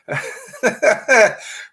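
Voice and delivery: real laugh way up high. Real, not acted. Amused by the chat's good mornings, a high real laugh. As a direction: let a giggle break out high and loud.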